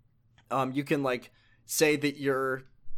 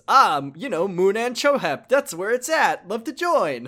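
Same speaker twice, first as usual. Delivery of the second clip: loud, higher, hammed-up eager recruit; Majima joining cult; 7 semitones higher, 8 dB louder than usual. Majima cheerfully asking the cultists to let him join, loud and hammy. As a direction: oversell it like a cheesy convert. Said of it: "Majima says".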